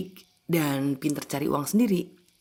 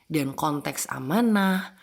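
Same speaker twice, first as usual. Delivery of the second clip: usual speaking voice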